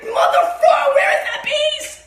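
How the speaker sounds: high, loud laughing yell; mock shout. Frustrated puzzler to the missing piece, laughing shout.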